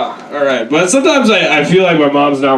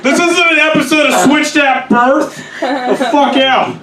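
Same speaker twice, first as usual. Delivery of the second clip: imitating a fed-up cop, pitched up; exasperated cop; 9 semitones higher than usual. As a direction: wave them off high and impatient. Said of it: "He's like".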